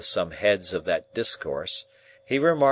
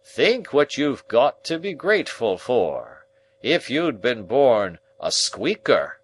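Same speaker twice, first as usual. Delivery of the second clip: louder, higher, slow rebuke; sharp pompous uncle; 5 semitones higher, 6 dB louder, slower than usual. Acted. Uncle turning sharp on the boy, loud and slow. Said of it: "turning sharp on me".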